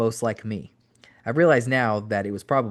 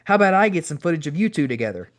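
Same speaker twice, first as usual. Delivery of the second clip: phony, over-exaggerated offer; higher-pitched fake enthusiasm; Owen; 6 semitones higher, 4 dB louder than usual. A narrator's reading. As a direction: overdo the friendly offer. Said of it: "in his phony, over-exaggerated way".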